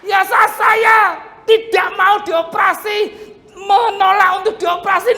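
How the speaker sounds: high, loud preaching shout; preaching at full force. Acted. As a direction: proclaim the miracle with full force.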